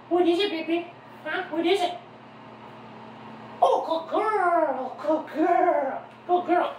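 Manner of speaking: high, slow cheerleader praise; schoolgirl voice. Real, not acted. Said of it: "schoolgirl voice".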